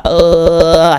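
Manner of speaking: long loud "ugh"; heartfelt groan. Retold or imitated. Feeling a quote in the whole body, long groan. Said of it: "I felt it through my heart".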